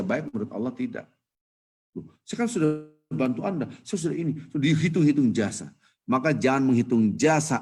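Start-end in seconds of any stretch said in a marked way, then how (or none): 1.9-5.7 mock indignant complaint. Acting someone counting favours owed.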